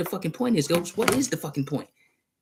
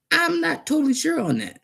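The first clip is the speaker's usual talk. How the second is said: usual speaking voice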